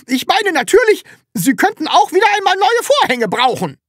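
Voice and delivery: very high, loud yell; high-pitched landlady's shout; loud scolding. A narrator's reading. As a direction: shriek a kind offer as if scolding. Flustered landlady yelling a kind offer.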